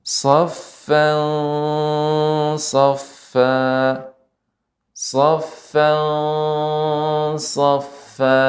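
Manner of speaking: Saffan and saffa recited with a heavy, thick emphatic s; thick ikhfa demo. Shown as a demonstration. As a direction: chant Saffan, saffa slowly with a full, thick Quranic sound. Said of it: "Saya akan membacakan beberapa saja contohnya".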